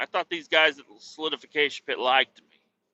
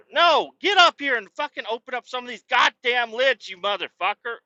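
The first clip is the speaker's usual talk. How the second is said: high, loud and fed up; angry berating; 10 semitones higher, 4 dB louder than usual. Retold or imitated. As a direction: chew him out to open the lids. Berating driver to open lids.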